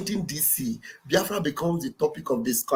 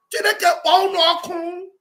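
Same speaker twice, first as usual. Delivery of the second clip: "Chineke kpọọ unu ọkụ" thrown out higher and louder; shouted curse; 11 semitones higher, 5 dB louder than usual. Retold or imitated. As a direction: hurl the curse at full voice. Cursing the movement's enemies.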